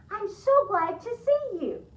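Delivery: high, loud, slow delighted welcome; glad greeting. Acted. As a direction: savor how glad you are. Missus Page greets warmly.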